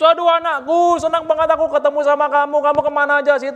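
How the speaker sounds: hammed-up happy dad, fast; overjoyed father. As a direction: gush with relief at finding the son. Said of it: "bapaknya Yesus ... ketemu sama Yesus".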